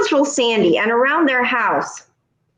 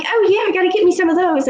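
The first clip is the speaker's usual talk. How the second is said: high, quick eager voice; imitating the neighbor; 8 semitones higher, faster than usual. Vanessa answering about boots, high quick eager voice. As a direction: chirp it back eagerly. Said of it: "She's like".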